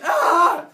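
loud, high, frustrated groan-cry; exasperated yell. Acted. Father losing the argument, exasperated cry. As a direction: let the frustration burst out.

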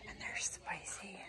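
hushed, slow whisper about the biting babies. Real, not acted. Whispering to viewers about her spicy babies. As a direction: breathe it like a secret.

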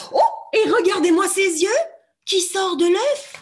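high, loud amazed voice; excited. Shown as a demonstration. Teacher showing the egg to children, high excited voice. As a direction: marvel out loud at the eyes.

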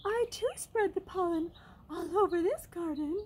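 grand little butterfly showing off; bragging. A narrator's reading. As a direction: boast in a lofty high voice. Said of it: "bragged the butterfly".

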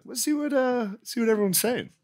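bright, high and curious; high eager voice. Retold or imitated. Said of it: "I was like".

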